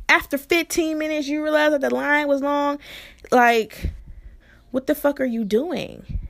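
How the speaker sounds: high-pitched, incredulous telling-off; indignant disbelief. Retold or imitated. Driver after fifteen minutes' waiting, indignant disbelief. Acted outrage at the long wait.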